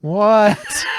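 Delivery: loud, high-pitched "what"; incredulous. Real, not acted. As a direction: burst out in amused disbelief. Startled by co-host's silly voice.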